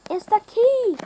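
high, loud cry of realisation; alarmed exclamation. A narrator's reading. Kipper sees himself in the mirror, exclaiming loudly.

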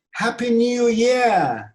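slow, drawn-out festive cry; exclaimed greeting. Shown as a demonstration. Teacher models celebrating New Year's Eve, exclaimed. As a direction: stretch out the cheer. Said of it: "Bu ses çıkmalı".

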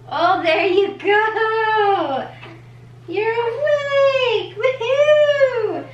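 high, slow, drawn-out cheer. Real, not acted. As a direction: sing it out high and delighted. Cheering baby awake.

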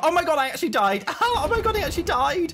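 high, loud disbelieving cry with laughter; laughing shock. Real, not acted. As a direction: shriek it, amused at your own death. Her game character just died.

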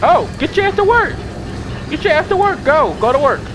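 shouting at the characters to get moving; yelling orders. Real, not acted. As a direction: bark it at lazy workers.

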